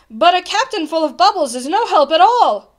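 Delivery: high, loud, emphatic verdict; exasperated. A narrator's reading. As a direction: declare it with urgent frustration. Bartholomew exasperated at useless captain.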